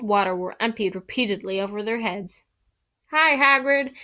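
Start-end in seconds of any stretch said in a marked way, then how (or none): 3.1-3.9 loud high call; yelled. Acted. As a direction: call out across the platform. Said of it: "Harry yelled".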